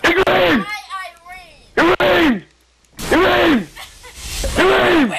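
high repeated calling out. Real, not acted. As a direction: shout her name across the map.

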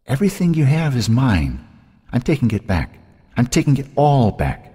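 otherworldly possessive menace; taunting sister. A narrator's reading. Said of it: "words she'd heard her sister speak".

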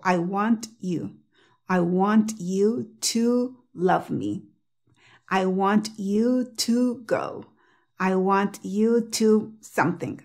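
slow careful 'I want you', each word separate. Shown as a demonstration. Teacher saying examples with every word pronounced, slowly. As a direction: spell it out word by word, T sounded in 'want'. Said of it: "lentamente, pronunciando cada palabra".

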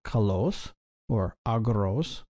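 slow "kalos or agros", each stressed on its last syllable; stress on ultima. Shown as a demonstration. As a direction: say "kalos or agros" slowly as ka-LOS, a-GROS. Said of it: "with accents on their ultima".

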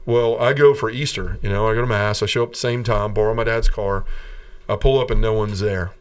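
normal talking voice

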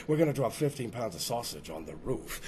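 deep, quiet snooty rich guy; rich jerk voice. Retold or imitated. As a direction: play the smug rich guy dropping sausage.

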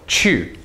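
falling CHU; fourth tone. Shown as a demonstration. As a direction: drop the pitch sharply on CHU. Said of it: "Fourth tone".

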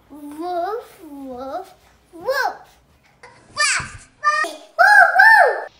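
barking like a dog, rising to a shout. Shown as a demonstration. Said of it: "A dog says".

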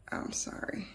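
whispered aside; whispered apology. Real, not acted. Reader whispering an apology mid-reading.

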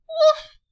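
one excited bark; a single loud puppy yip. Acted. Dog barks in picture book.

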